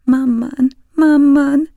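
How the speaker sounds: put-on high, loud wailing child; frightened crying. A narrator's reading. Terrified boy crying for his mother, frightened wail. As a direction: sob out for mother in terror. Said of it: "rémült sírása".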